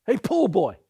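loud holler to get attention; calling out. Retold or imitated. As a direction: call it out sharply to someone leaving. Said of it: "he calls out".

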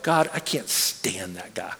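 low slow muttered thought under the breath. Retold or imitated. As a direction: grumble it to yourself, slowly and quietly. Inner thought about rude coworker.

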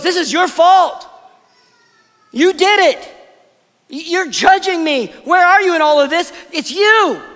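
high, loud, bitter outburst at God; blaming God loudly for her loss; frustrated accusation. Retold or imitated. Naomi frustrated at God, loud high accusing outburst. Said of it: "She's frustrated with him".

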